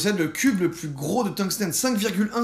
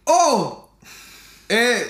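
strained grunts lifting something heavy; carrying heavy load; 5 semitones higher, 6 dB louder, much slower than usual. Real, not acted. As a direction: exclaim from sudden weight in the hand. Lifting the tungsten cube.

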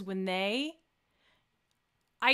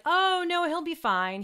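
high, louder "oh, no", then settling; breezy mock reassurance; 9 semitones higher, 4 dB louder, slower than usual. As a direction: wave the worry away, imitating someone. Retold sudden backtracking reassurance.

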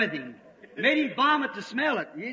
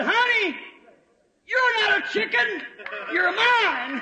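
preacher voicing the mother eagle crying out; mother eagle's call; 8 semitones higher, slower than usual. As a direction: call down to the little one from above. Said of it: "he screamed".

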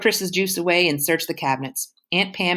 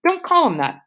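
sharp rebuke; 13 semitones higher, 4 dB louder than usual. Acted. Starr defending boyfriend.

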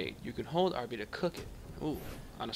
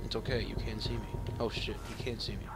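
unvoiced hushed whisper; whispering while sneaking past enemies; 4 semitones lower, 7 dB quieter than usual. Real, not acted. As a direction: whisper so they don't hear. Hiding from enemy.

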